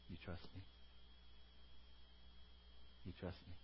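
low, very soft reassurance; quiet, loving appeal for trust; tender Abba Father. Acted. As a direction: speak as God, gently and intimately.

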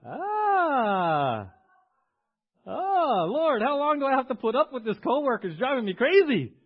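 loud, high wail of mock despair; hammed-up groaning complaint to God; exasperated worker. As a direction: cry out as if at wit's end. Acted exasperated worker praying about coworker.

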